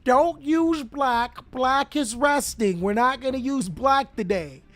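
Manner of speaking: teacher voice. Acted. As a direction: draw out each rule like a mock teacher. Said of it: "She said".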